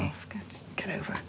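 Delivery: exasperated whisper under breath; whispered exasperation. Real, not acted. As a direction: hiss it out in disbelief.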